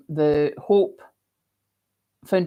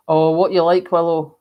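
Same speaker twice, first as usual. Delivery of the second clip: normal talking voice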